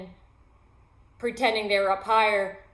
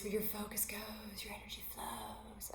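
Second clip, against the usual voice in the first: much softer, breathy whisper on "Where your focus goes"; whispered yoga voice; 19 dB quieter than usual. Shown as a demonstration. Yoga teacher whispering her mantra to the class. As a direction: hush it like a yoga teacher in savasana. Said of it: "I might say it like this".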